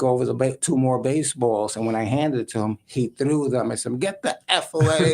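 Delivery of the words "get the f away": mock angry snarl, higher; imitating a hungover star blowing up; retold outburst, pitched up; angry yell. Mantle yelling at bat boy.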